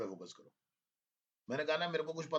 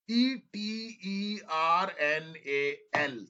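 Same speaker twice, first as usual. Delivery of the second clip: each letter spelled out slowly and a bit louder; slow spelling dictation; 4 semitones higher, 7 dB louder, much slower than usual. Shown as a demonstration. Teacher spelling eternal slowly for students to check. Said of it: "check कर लो".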